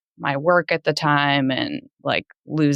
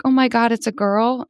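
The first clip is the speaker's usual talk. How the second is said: higher-pitched retold exclamation; a raised, questioning gasp of news; retold surprise; 8 semitones higher than usual. Mother retelling her reaction at the scan, surprised.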